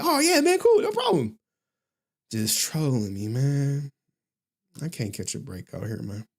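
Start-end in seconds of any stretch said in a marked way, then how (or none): 0.0-1.4 very high, loud, gullible yes; hammed-up squeaky agreement; mock eager agreement. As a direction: act naively thrilled, voice way up high. Retells own gullible reply.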